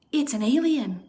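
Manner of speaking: ordinary delivery, nothing marked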